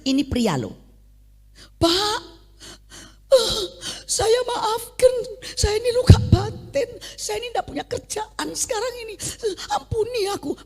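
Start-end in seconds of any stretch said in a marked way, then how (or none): 1.8-10.6 much higher, sobbing plea for forgiveness; crying man. Retold or imitated. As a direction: beg through tears. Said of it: "langsung dia nangis". (pria menangis memohon ampun)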